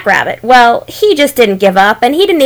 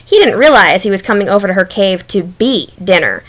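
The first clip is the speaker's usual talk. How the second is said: ordinary delivery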